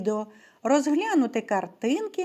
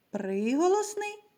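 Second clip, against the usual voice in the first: normal talking voice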